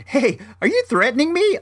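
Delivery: high, joking question; playing it off lightly. Buyer to seller waving screwdriver, playing it off lightly. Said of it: "I try to play it off lightly".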